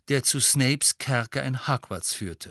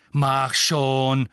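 impatient; 7 dB louder than usual. A narrator's reading. Impatient at the lift.

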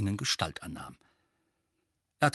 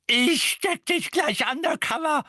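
high, loud, furious screech; screaming; 5 dB louder than usual. A narrator's reading. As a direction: scream a threat at the rogue. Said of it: "schrie Misses Figg".

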